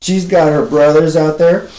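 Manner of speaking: normal talking voice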